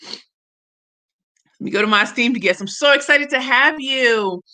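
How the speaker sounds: ordinary talk, nothing marked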